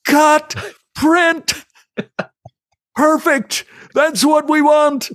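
the director loves the take and says so; delighted director. Retold or imitated. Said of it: "and Ridley Scott goes".

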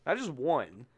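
normal talking voice

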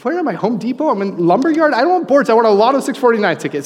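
high, loud mock exasperation; exasperated. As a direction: act baffled that she's talking lumber. Retelling baffled reply to clerk.

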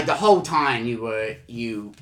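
ordinary talk, nothing marked

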